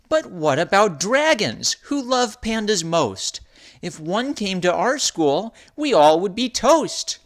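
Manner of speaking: put-on high, worried child voice; worried little girl. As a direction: ask it like a scared little cub. Nua worried question.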